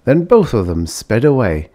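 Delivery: usual speaking voice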